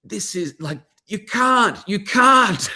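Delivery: mock-yell the wife's capital-letter notes; shouting in capitals. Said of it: "big capitals".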